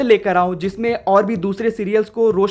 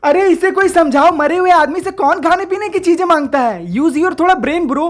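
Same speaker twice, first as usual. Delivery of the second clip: exasperated rant; 6 semitones higher than usual. Real, not acted. Mocking absurd scene.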